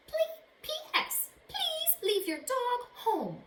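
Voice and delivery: high, slow, begging note read aloud; pleading letter voice. Acted. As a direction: beg like a fussy cousin.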